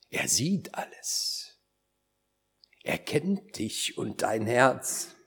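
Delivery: slow, low and intimate; slow right down, half-whispering the assurance; hushed assurance. Real, not acted. Preacher assures a listener that the Lord knows the heart, hushed assurance.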